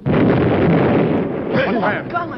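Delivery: shocked gasp then exclaim; startled by the blast. Acted. Explosion seals mine.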